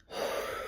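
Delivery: long unvoiced exhale; breathy relief sigh. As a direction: let the held breath go in a sigh. Letting out held breath.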